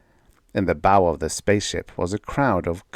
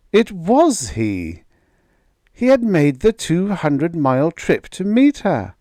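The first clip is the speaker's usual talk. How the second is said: excited; 9 semitones higher, 5 dB louder than usual. A narrator's reading. Joyce's joy at seeing Greg.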